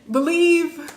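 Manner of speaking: Believe said high and loud, falling; stress on believe. Shown as a demonstration. Telling her audience what to do, emphatic Believe. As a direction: hit Believe hard and let it drop.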